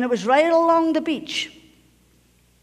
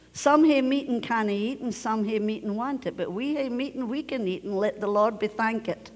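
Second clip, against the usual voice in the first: Robert Burns verse recited in broad Scots; 4 dB quieter than usual. Shown as a demonstration. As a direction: recite it quietly in Scots dialect. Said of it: "well, I'll say it in Scottish".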